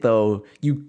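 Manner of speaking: ordinary talk, nothing marked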